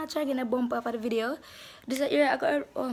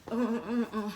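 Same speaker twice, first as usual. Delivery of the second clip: ordinary talk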